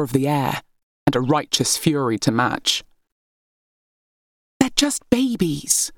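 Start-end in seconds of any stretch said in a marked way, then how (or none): 4.6-5.9 higher, slow, pleading protest. A narrator's reading. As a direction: gently defend the little brothers. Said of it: "Cleopatra protested".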